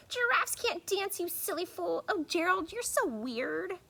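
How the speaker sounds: high mocking jeer; sneering taunt. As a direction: taunt the clumsy giraffe. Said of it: "the animals all sneered".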